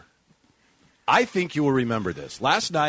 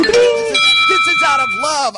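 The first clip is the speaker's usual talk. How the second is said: much higher and louder, insisting; emphatic protest; 11 semitones higher, 10 dB louder than usual. Real, not acted. Protesting to listeners texting in, loud and high. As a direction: loudly plead it is affectionate.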